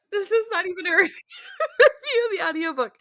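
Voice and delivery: high, loud, breaking into laughter; real laughing protest. Real, not acted. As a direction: laugh helplessly through the words. Laughing at the tangent.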